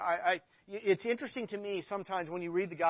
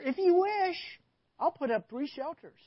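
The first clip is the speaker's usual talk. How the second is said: eager Peter; 8 semitones higher, 5 dB louder than usual. Acted. Peter voiced, pitched up.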